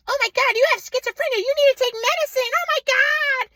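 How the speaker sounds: very high hysterical mock-mother voice; high hammed-up panic, drawing out the last words; mom freaking out. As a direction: imitate a panicking mom in piercing falsetto. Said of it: "she was, like, freaking out".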